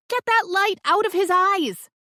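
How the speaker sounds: high, loud angry order; yelled. Acted. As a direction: yell at whoever holds the light. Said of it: "Bernie yelled".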